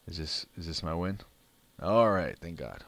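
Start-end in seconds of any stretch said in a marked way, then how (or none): 1.8-2.8 loud, relieved all right; relieved cheer. Real, not acted. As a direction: let the tension go in a burst. Relieved after winning match.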